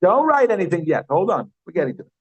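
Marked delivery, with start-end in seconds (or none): none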